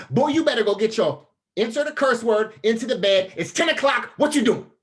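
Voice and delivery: loud, high-pitched yell of a furious father; angry dad yell. Retold or imitated. Dad yelling at his son on the phone past bedtime, furious shout. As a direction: bark it like a dad who has had enough.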